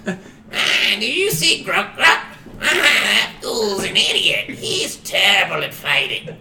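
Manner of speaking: high, loud goblin voice; cackling goblin. Acted. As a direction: jeer at a useless comrade in a squawky goblin voice. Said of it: "talking shit about one of the other goblins".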